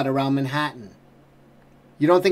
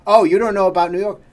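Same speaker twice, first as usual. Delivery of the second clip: mocking quote; 3 semitones higher, 6 dB louder, faster than usual. Mocking the kids' ignorance.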